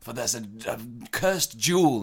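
high, hammed-up heroic urgency; mock adventurer. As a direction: declare the next quest like an adventure film. Mock adventurer announces next quest.

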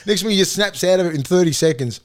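ordinary talk, nothing marked

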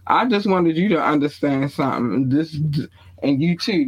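ordinary talk, nothing marked

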